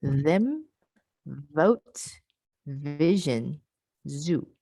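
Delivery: careful, drawn-out voiced consonants; voiced fricatives demo. Shown as a demonstration. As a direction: lean on the buzz in them, vote, vision, zoo. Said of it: "I can say it again".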